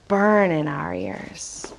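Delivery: slow, low "Burn in" for burning, no g; dropped g. Shown as a demonstration. Teacher having children listen, slow dropped-g "Burn in". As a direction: stretch "Burn in" slowly without the g. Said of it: "might notice something familiar".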